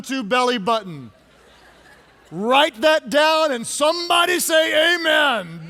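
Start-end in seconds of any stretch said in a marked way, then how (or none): 2.3-5.7 raised, emphatic preacher's call; rousing call-out. Real, not acted. Preacher rallies congregation to say amen, rousing call-out. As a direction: call out for an amen, high and loud.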